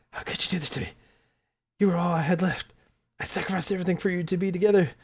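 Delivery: high, hurt accusations, loud at times; betrayed game girl. Acted. Confronting the player who deleted her, high and wounded. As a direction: cry out at the one who deleted you. Voices the character accusing him.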